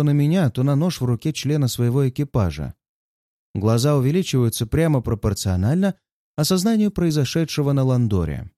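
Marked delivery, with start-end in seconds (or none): none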